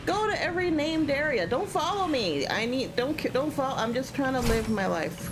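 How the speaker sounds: high, fleeing voice begging not to be followed; mock game character pleading. Streamer voicing her own character fleeing an enemy, high and pleading. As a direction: squeak it out like a hunted player. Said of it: "the one that's like".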